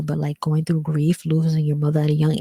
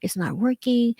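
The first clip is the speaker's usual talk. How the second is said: higher, slow complaining whine; higher, slow exasperated self-talk about hair products; acted frustration; 6 semitones higher, slower than usual.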